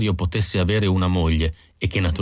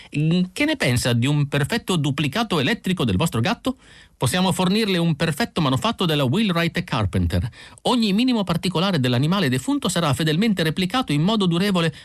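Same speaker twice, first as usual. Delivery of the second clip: higher, fast, eager pitch of a salesman; 10 semitones higher than usual. A narrator's reading. Isidor suddenly pitching an electric cat, higher and fast. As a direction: rush to sell the idea.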